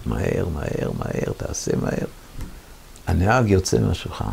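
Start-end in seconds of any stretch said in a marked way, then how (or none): 0.1-2.1 lower, much softer whispered plea; whispered prayer. Retold or imitated. Soldier prays under his breath for the pump, whispering. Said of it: "אני מתפלל לקדוש ברוך הוא".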